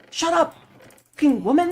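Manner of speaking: high-pitched mock outburst; imitating angry teammate. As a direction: snap it like an angry gamer, mockingly. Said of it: "this random guy on our team starts crashing out".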